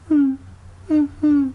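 high little sleeping-dog squeaks; high-pitched whimpering hums; imitated dog whimpers. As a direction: imitate a dreaming dog's short high hums. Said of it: "He's going".

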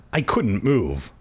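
ordinary delivery, nothing marked

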